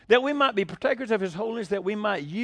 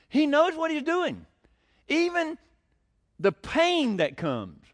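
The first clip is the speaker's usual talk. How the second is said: preaching emphasis; 9 semitones higher, 3 dB louder, slower than usual. Real, not acted. Preacher building to a point.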